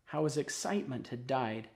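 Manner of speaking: ordinary delivery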